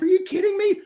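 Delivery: a very high, loud, mock-judgmental question; judgy disbelief. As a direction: scoff at her like she can't be serious. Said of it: "at first I was even a little judgy".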